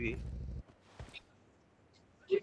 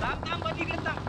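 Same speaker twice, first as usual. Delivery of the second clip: urgent high call; 10 semitones higher than usual. Real, not acted. Urging teammate to hold.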